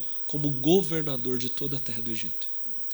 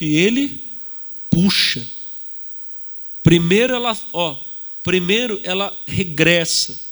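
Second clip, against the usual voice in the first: emphatic; 3 semitones higher, 11 dB louder than usual. Real, not acted. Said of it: "arrow drawn back demo".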